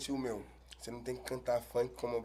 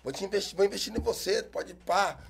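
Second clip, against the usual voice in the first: higher, louder hyped-up promise; fake businessman; 6 semitones higher, 6 dB louder than usual. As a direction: play the smooth talent scout. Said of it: "fala assim".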